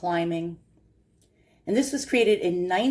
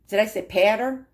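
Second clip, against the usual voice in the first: thick New York accent. Shown as a demonstration. New Yorker joking to viewers, broad New York accent. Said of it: "I meant powder".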